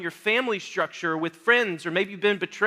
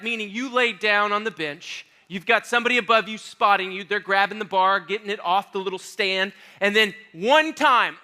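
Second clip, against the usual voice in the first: ordinary delivery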